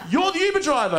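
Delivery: high, incredulous question; can't believe it's him; disbelieving. Retold or imitated.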